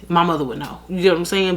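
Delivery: ordinary delivery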